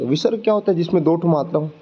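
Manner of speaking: usual speaking voice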